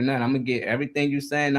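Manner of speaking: ordinary talk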